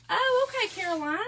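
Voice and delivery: high, loud oh okay; surprised. Real, not acted. Reacting to Caroline's comment, surprised. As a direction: caught off guard by a comment.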